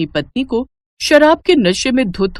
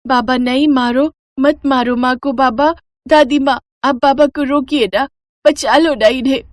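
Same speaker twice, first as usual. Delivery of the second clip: put-on child pleading; 6 semitones higher than usual. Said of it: "अपनी माँ की पिटाई होती देख नीलम".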